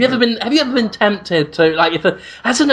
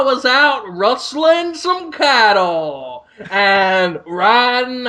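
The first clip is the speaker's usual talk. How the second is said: bad American accent, British still audible; mock American accent; 4 semitones higher, slower than usual. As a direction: play a cowboy poorly. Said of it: "It still sounds so British".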